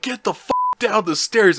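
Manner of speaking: high-pitched retold yell. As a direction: imitate an urgent yelled order. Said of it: "he's, like, yelling at him".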